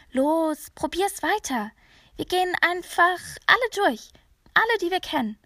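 high, loud pushing plea; urgent urging. A narrator's reading. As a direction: urge her on, don't give up. Child urges mother to keep calling. (drängend und aufgeregt)

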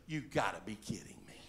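low, whispery disbelief; very soft, dry aside; smart-mouth disbelief. Acted. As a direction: mutter it under your breath, incredulous. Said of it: "the smart mouth that I am".

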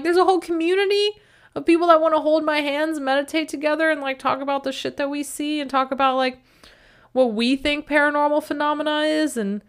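high, fast, eager rant; excited. Retold or imitated. Excited retort about community.

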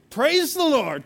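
high, loud burst of praise; mock worshipful exclamation. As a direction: exclaim it like a churchgoer mid-worship. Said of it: "went from in a moment".

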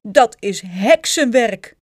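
loud, slow exclamation; calling out. A narrator's reading. Husband blaming witchcraft for the wings, calling out. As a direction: declare it loudly, dragging out the words. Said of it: "riep meneer Krijtjes".

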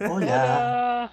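high, breathy-free sing-song seductive hello; seductive hola. Retold or imitated. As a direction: purr it out like a crooner, pitched high. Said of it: "Te seduce con la voz".

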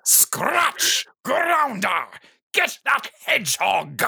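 high cartoon villain calling out; Robotnik shouting orders.

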